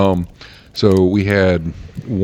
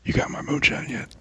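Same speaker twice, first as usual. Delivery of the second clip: put-on whispered Alabama accent; 6 dB quieter than usual. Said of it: "How does an Alabamian, uh, whisper".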